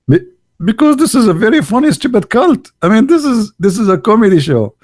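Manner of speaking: high, fast excited laughing; worked up and mocking. Real, not acted.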